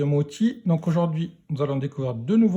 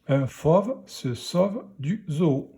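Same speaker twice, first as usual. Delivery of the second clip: careful, spaced reading for beginners; measured early-reader pace; slow word-by-word reading; slower than usual. Shown as a demonstration. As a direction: read slowly, a small gap after each word. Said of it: "pour la lecture de la phrase".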